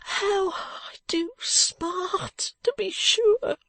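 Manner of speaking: very high, tearful, drawn-out wail; groaning. A narrator's reading. Beaten man lamenting his injuries, wailing groan. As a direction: sob it out very slowly in pain. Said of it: "groaned Mister Fledgeby".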